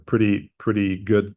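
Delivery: ordinary talk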